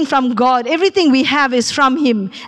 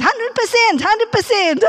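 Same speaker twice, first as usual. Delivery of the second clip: imitating young driver; 9 semitones higher than usual. Young driver's quoted reply.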